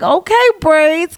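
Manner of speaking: high, slow playful hype; teasing compliment. Retold or imitated. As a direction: draw it out high to tease a friend.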